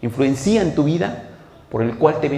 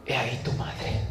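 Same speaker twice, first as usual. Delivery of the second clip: muy bajo, voz de quien agoniza; moribundo; 4 semitones lower, 11 dB quieter than usual. Acted. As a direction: dilo apenas, con las últimas fuerzas. Jesús moribundo habla a Juan. (moribundo, casi sin voz)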